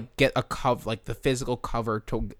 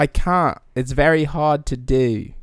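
bad British accent; 3 semitones higher, 5 dB louder, slower than usual. Shown as a demonstration. Host struggling with the imitation, bad British accent. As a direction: complain in a fake British accent. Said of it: "do a British accent".

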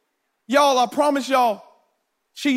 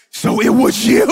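accusing; 7 dB louder than usual. Retold or imitated.

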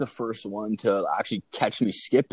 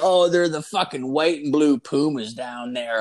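higher-pitched drunk girl impression; 5 semitones higher, 3 dB louder than usual. Drunk girl pointing out her shoes to him, tipsy. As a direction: imitate a tipsy girl in a raised voice. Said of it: "She's, like, so drunk".

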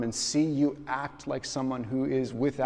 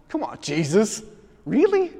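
mock protest; 8 semitones higher, 9 dB louder than usual. Speaker mock-protesting Jesus.

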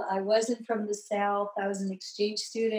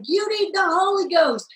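fervent preacher; 7 semitones higher, 5 dB louder than usual. Retold or imitated. Preacher urging her.

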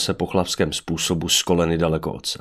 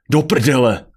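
higher, much louder startled yell; roared curse; 7 semitones higher, 8 dB louder, slower than usual. A narrator's reading. Adrian curses as the boulder lands, roaring. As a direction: yell it out in shock. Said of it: "zařval Adrian".

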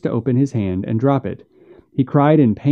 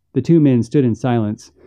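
usual speaking voice

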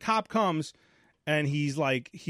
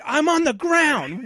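high, loud, slow yell from the ground; frantic shout; 11 semitones higher, 8 dB louder, slower than usual. Retold or imitated. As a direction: bellow the obvious at the phone. Shouting reply to operator.

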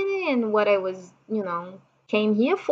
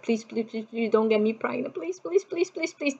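retold pleading prayer; 7 dB quieter than usual. Wife asking God not to get pregnant, retold hushed pleading. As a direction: beg under your breath, pitch climbing. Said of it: "again asking to God".

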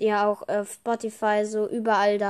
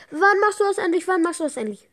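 mock fan pestering, higher and louder; impatient fan; 9 semitones higher, 5 dB louder, faster than usual. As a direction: nag him like an impatient listener. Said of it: "mit Sprachnachrichten zuballert".

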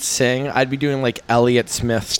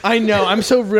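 higher, louder joking; higher ironic agreement; mock self-deprecating; 11 semitones higher, 4 dB louder than usual. Real, not acted. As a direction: play up the rudeness. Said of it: "Maybe you'd get more invites".

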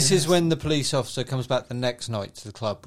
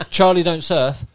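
laughing; 4 semitones higher, 6 dB louder than usual. Retold or imitated. Laughing film quote.

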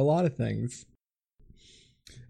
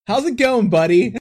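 much higher, louder check-in; put-on chummy greeting; 10 semitones higher, 8 dB louder than usual. Host turns to co-host after joking about weeping, put-on chummy greeting. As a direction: hail your co-host loudly, pitched way up.

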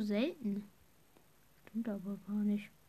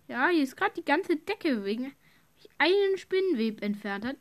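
yelling across the room; 4 semitones higher, 7 dB louder than usual. Real, not acted. Telling dad across room.

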